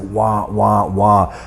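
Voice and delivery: lightly imitated Charlie Brown grown-up babble; wordless cartoon wah-wah, evenly spaced; muffled cartoon-adult wah. Dazed boy hears talk he can't make out, imitated cartoon wah-wah. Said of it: "like that Charlie Brown cartoon".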